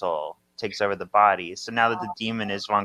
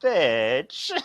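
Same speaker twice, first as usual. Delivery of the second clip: drawn-out comic retort; 3 semitones higher, 4 dB louder than usual. Acted. Third sister's line, played for laughs.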